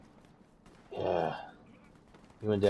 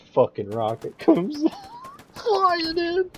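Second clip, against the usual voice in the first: high shrieking laughter through words; laughing hard; 7 dB louder than usual. Real, not acted. Laughing at rocket kill.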